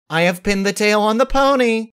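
loud, high excited shout; high-pitched gleeful shout. Acted. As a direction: shout it out in triumph. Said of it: "shouted Pinkie".

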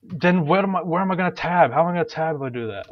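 loud and high, exasperated at the game; frustrated yelling. Real, not acted. Gamer yelling at the screen, really frustrated. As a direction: shout it out, stuck and annoyed.

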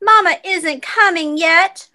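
ordinary delivery, nothing marked

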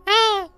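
very high, loud "ah"; high scream. Pretend. Player crying out after being killed in the game, high scream. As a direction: let out a piercing high yelp.

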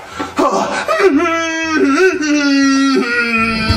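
drawn-out sobbing wail; long crying wail. Acted. Cook realising he is deaf, long crying wail. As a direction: cry in one long moan. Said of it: "he's crying".

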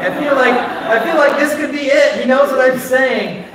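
loud, high-pitched insistence, drawn out; emphatic insisting. Retold or imitated. Telling husband this is the bus, insistently. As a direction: insist at full tilt, high and slow.

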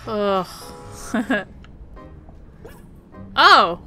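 ordinary talk, nothing marked

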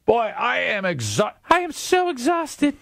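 high falsetto sigh of exhaustion. Pretend.

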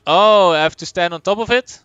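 normal talking voice

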